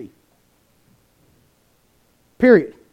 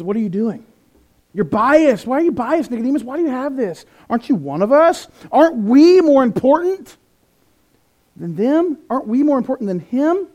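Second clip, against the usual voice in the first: indignant Pharisee; 5 semitones higher, 4 dB louder than usual. Retold or imitated. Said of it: "he says".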